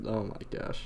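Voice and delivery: ordinary talk, nothing marked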